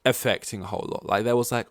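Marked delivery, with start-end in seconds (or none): none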